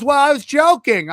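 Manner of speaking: mock-defensive, pitched well up; retold protest. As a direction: play an indignant excuse, voice lifted. Retold defensive reply from the comedian.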